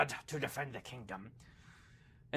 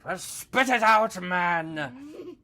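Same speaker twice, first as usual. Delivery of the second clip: impatient captain; 6 semitones higher, 5 dB louder, much slower than usual. Acted.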